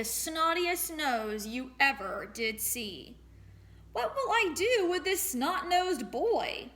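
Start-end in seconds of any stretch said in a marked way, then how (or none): 3.9-6.7 exasperated flower seller. Acted. Flower seller complains in story.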